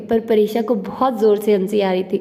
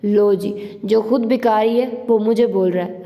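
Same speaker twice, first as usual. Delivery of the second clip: ordinary delivery, nothing marked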